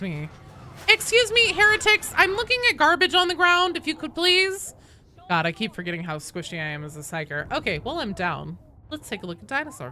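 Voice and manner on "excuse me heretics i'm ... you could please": high, loud put-on huffiness; mock indignation. Player telling heretics off for interrupting, mock indignant. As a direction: scold them in an exaggerated high voice.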